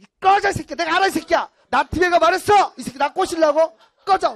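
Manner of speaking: high, loud angry shouting; furious rejection. Acted. Woman rejecting a palm-reading seducer, loud mock fury. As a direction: yell him away in hammed-up outrage.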